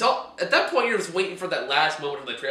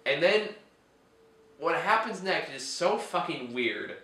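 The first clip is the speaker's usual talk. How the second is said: ordinary delivery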